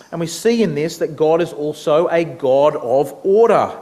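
normal talking voice

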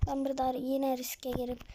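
ordinary talk, nothing marked

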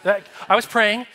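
plain reading voice

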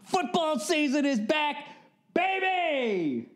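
high, drawn-out football fan yell; hammed-up celebratory shout, slow and high; hyped holler. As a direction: whoop it like an announcer opening the season. Hollers that football has returned.

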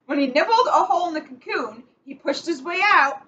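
picture-book read-aloud, measured and clear; reading to children. Acted. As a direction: read it like bedtime story time for kids. Reads picture book to kids.

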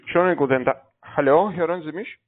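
loud, high hello down the line; calling out. Real, not acted. Caller checks whether the man on the phone can hear, loud and high. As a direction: call out to be heard on a bad line.

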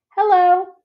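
a tiny elephant calf piping up; small child's voice. A narrator's reading. Ruby greets Ivan in a high little voice. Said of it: "says a small, clear voice".

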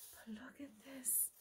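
hushed whisper to the camera. Real, not acted. Whispering to viewers about the find. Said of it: "I don't know if I can show you guys".

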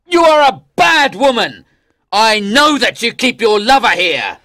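high, loud accusing king; angry shout. Acted. King accuses wife over her lover, shouting angrily. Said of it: "he shouted".

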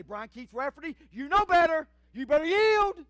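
loud, high stern warning voice; the spirit scolding him by name; inner spirit warning. Retold or imitated. The spirit warning the pastor, loud and stern. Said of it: "my spirit will rise up and say".

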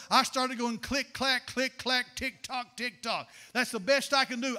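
ordinary talk

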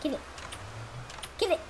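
shocked shout. Real, not acted. Sudden surprise.

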